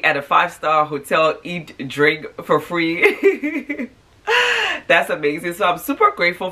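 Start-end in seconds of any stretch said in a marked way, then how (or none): 1.4-4.9 laughing. Real, not acted. Laughing at own good luck.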